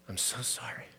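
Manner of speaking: soft whisper, barely voiced; a father's whispered sorry at the bedside; whispered comfort. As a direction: breathe it into the child's ear. Dad comforts crying son.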